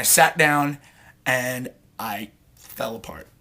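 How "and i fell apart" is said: soft, slow, near whisper; hushed confession. Real, not acted. Telling how he finally sat down, hushed and slow. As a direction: let the voice drop away, slowly. Emotional climax of his story.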